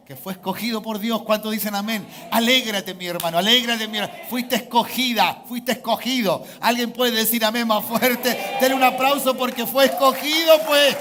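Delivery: full-force preaching. Real, not acted. Preacher urging church to applaud being chosen, loud and exultant. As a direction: rouse the congregation with a ringing shout.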